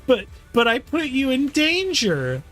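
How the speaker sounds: high, slow stammered protest; hammed-up high guilty whine; guilty character protesting. Character guiltily protests being forgiven.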